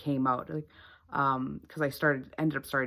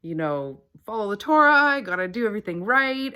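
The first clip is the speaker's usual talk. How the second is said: rigid self; 6 semitones higher, 5 dB louder, faster than usual. Retold or imitated. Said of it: "I'm like".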